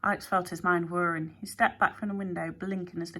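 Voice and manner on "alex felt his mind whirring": usual speaking voice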